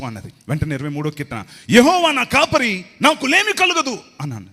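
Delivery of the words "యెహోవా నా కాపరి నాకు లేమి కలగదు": loud, high, slow shouted verse; shouting. Retold or imitated. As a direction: belt the psalm out like a preacher. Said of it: "అరుస్తున్నావేంట్రా?". (అరుస్తూ చదవడం)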